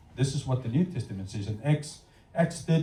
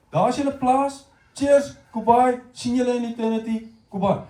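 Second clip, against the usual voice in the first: breezy send-off; 8 semitones higher, 4 dB louder than usual. Acted. God imagined brushing them off.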